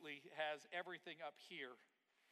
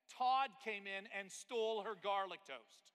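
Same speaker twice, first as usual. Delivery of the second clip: much louder and slowed, landing the punchline; dramatic reveal; 5 semitones higher, 12 dB louder, slower than usual. Acted. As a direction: drag it out for effect.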